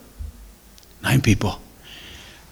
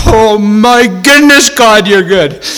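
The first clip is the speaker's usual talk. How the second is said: loud, high cry of amazement; overwhelmed praise; 9 semitones higher, 6 dB louder, slower than usual. Retold or imitated. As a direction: exclaim gratitude to God, drawn out. Overwhelmed praise in retold reunion.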